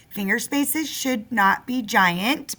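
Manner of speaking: ordinary delivery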